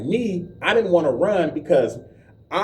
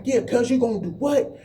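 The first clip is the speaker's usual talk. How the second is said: taunting challenge; 8 semitones higher than usual. Retold or imitated. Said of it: "He was like".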